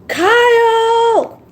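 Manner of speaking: loud high call of Kyle; screaming a name. Shown as a demonstration. Vlogger practicing calling out to a viewer, loud call. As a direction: belt the name out across the room. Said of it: "I've been practicing screaming out for you".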